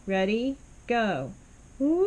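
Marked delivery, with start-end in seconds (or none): 1.8-2.1 loud woo whooping up high into head voice. Shown as a demonstration. Teacher demonstrates the roller coaster reaching the top of the voice. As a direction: shoot the sound up into your head. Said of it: "Let's try it together".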